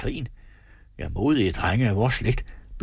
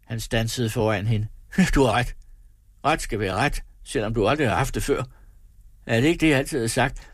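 ordinary delivery, nothing marked

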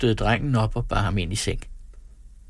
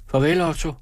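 higher, called goodbye; calling out; 8 semitones higher than usual. A narrator's reading. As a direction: call it back across the room. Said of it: "råbte hun". (råbende farvel)

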